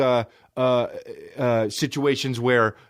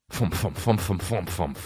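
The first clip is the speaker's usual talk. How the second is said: imitated muffled noises; 6 semitones lower, 4 dB quieter than usual. Shown as a demonstration. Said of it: "That's what I want your fucking mouth to be doing".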